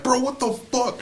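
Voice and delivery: high-pitched imitation of an outraged brother; mock outburst of startled protest. Brother hit by toy, retold.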